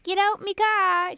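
high, loud, drawn-out mock order; imitated mom. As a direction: yell it slowly as the mimicked mom. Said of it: "the mom's like".

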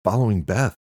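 wound-up caller on the move; manic. A narrator's reading. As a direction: report it in an agitated high pitch. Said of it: "He sounded manic and out of breath".

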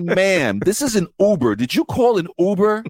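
mock incredulous driver; exasperated.

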